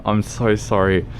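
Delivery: usual speaking voice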